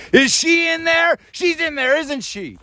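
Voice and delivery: high imitated yell from outside; mock yell. Woman in truck yelling at the van, high mock yell. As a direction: pitch way up, calling out.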